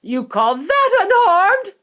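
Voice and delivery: high, loud, slow indignant snap; outraged retort; mother snapping at Wonka; snapped. A narrator's reading. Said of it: "snapped Missus Teavee".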